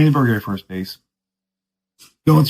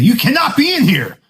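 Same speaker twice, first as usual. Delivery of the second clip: much higher mock reprimand; retold stern warning, higher; imitated guard scolding; 11 semitones higher than usual. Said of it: "He's like".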